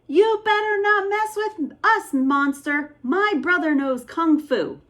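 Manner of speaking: put-on bluffing kid. Boy bluffing the monster, mock tough.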